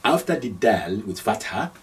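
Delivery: ordinary talk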